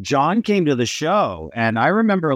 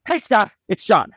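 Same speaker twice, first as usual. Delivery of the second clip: breathless, excited phone greeting; lightly breathless imitation of a friend; out of breath; 7 semitones higher than usual. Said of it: "he's always sounds a little out of breath".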